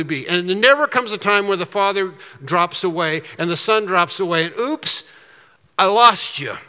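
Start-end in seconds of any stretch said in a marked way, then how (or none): 4.6-6.6 light, high mock voice; lightly voiced careless oops; mock careless slip. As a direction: pretend God fumbling, lightly voiced. Voicing a careless God.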